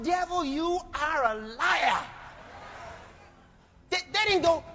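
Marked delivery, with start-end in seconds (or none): 0.0-2.0 women of faith shout down the devil, slow and high; defiant shout. Retold or imitated. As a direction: rebuke the devil with full force. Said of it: "They were out there saying".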